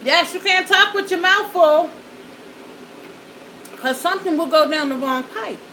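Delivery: ordinary delivery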